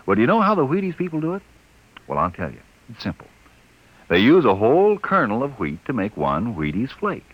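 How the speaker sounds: upbeat radio commercial pitch; ad read. Acted. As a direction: sell the cereal brightly.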